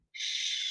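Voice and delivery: sh drawn out slowly and soft; teacher modeling a digraph; sh sound. Shown as a demonstration. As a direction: hold the sh sound. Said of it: "I hear at the beginning a digraph sh".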